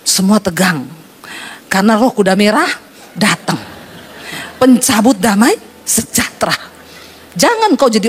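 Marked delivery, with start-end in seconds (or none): none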